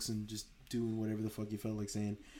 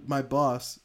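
ordinary talk

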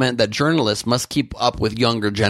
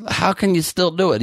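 ordinary talk

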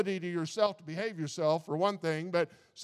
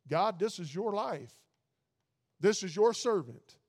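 earnest prayer; 3 semitones higher, 3 dB louder than usual. Pretend. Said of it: "prayer voiced for listener".

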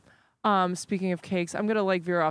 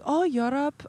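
put-on rough Dutch accent, pitched higher; 6 semitones higher, slower than usual. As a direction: imitate a Dutch traveller, not very accurately. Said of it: "that's not really a good Dutch accent".

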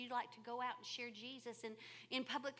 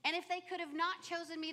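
ordinary delivery

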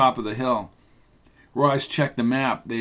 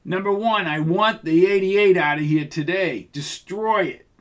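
clear loud enunciation; 5 semitones higher than usual. Acted. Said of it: "His clear and loud voice enunciated every word".

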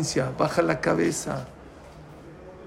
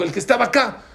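high, raised-pitch answer; alarmed reply; 7 semitones higher, 4 dB louder, faster than usual. Retold or imitated. Employee answering the pale owner, alarmed. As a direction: blurt it out, pitched up.

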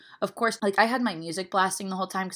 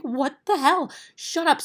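high, loud fed-up outburst; exasperated; 9 semitones higher, 4 dB louder than usual. Retold or imitated. Annoyed passenger reacting to doom-talk, high and loud. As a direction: snap at them to stop, pitched way up. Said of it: "I'm just like".

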